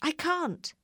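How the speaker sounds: high-pitched call back; shouted reply. A narrator's reading. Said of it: "shouted the balloonist".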